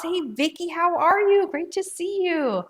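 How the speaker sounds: bright, high welcome for a regular viewer; excited greeting. Real, not acted. Host greets Vicky in the live chat, excitedly.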